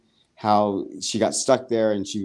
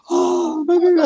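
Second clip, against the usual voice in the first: high, loud mock squeal over the baby; gushing squeal; 4 dB louder than usual. Recapping Isaac holding up his newborn, high gushing squeal. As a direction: squeal it like a thrilled new parent.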